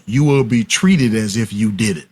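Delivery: ordinary talk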